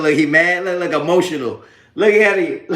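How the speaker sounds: loud gloating taunts; loud mocking. Real, not acted. Mocking a troll he thinks is mad, loud jeers. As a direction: point and jeer at him.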